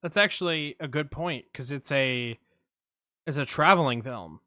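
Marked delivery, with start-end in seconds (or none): none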